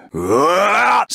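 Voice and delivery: long drawn-out high cry of pain; anguished battle scream. Acted. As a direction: let the hit tear a slow scream out. Character hit in battle.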